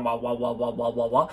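sing-song wah wah wah for waves; lightly voiced wobbling wahs; imitated sound waves. Shown as a demonstration. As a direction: bounce the syllables like a wave. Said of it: "they're waving up and down".

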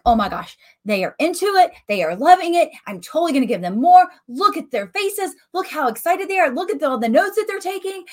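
high, eager, rushing with delight; thrilled at an engaged crowd; excited speaker inner voice. Pretend. A speaker thrilled by the audience, eager and high.

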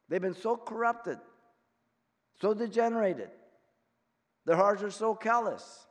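loud, high, full-force preaching; fiery preaching. Acted. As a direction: preach it hard to the congregation.

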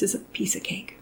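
soft whispered punchline. Real, not acted. Wife to interviewer, whispered playful punchline.

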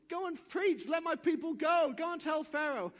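high, forceful commissioning; divine command. Acted. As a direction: send him out with ringing orders. God commissions Moses.

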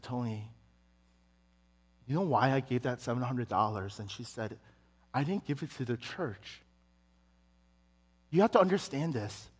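a mother quietly setting her son straight; gentle mother. Retold or imitated. Mom explaining her seven hundred dollar gift, softly.

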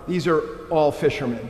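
usual speaking voice

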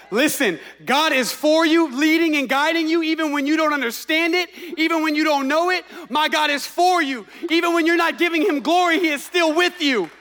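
fervent full-force preacher; preaching. Acted. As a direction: preach it loud and urgent. Pastor preaching with rising force.

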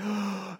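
big gasp as from underwater; surfacing gasp. Shown as a demonstration. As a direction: demonstrate the first breath at the surface. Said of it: "that breath just feels so good".

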